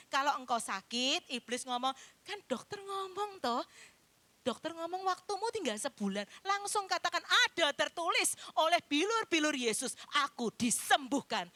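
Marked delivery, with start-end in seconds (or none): 2.2-6.2 mock devil taunting the sick; sly devil voice. 7.2-11.5 louder, firm proclamation; rebuking the devil with scripture; bold declaration. Acted.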